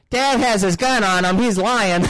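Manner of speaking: put-on high, eager kid voice tattling; little son ratting out dad to the cop, high kid voice; snitching kid. Son tells the cop dad is armed, high tattling voice.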